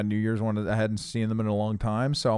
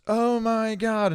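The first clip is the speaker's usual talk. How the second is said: mock drunken squeal; 10 semitones higher, much slower than usual. Drunk girls react, retold.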